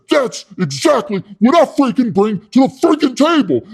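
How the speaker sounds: high, loud pitchman bravado; imitating an over-aggressive self-promoter; hyped-up bragging. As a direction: brag at full tilt, voice pushed up. Pitchman bragging about his energy.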